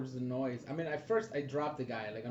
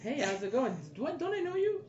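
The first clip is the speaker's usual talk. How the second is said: fake friendly voice; 10 semitones higher than usual. Player acts casual greeting.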